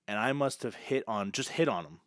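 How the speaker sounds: usual speaking voice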